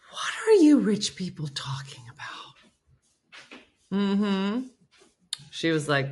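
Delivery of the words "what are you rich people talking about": slow hushed whisper. Retold or imitated. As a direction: whisper it like a secret aside. Whispered aside about rich people.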